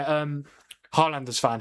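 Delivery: usual speaking voice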